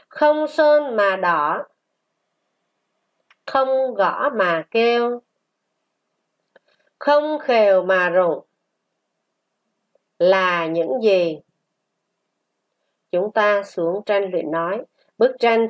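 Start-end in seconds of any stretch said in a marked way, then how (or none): 0.1-11.4 slow, sustained syllables; model reading. Shown as a demonstration. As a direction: read it slowly for a class to repeat. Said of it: "Chúng ta đọc lại". (đọc mẫu chậm, ngân dài)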